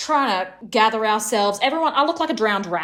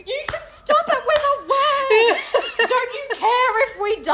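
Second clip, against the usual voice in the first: frantic plea; 14 semitones higher than usual. Retold or imitated. Quoting the sailors' plea, high and half-crying. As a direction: beg desperately through laughter. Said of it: "I was like".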